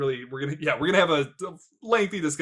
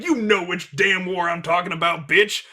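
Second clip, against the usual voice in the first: put-on gruff old man voice; 4 semitones higher, 5 dB louder than usual. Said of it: "And he's like".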